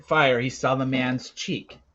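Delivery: plain reading voice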